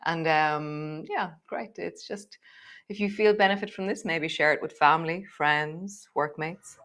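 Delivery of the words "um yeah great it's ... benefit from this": ordinary talk, nothing marked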